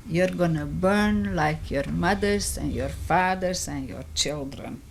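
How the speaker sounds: ordinary talk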